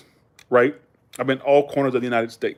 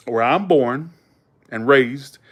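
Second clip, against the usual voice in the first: ordinary delivery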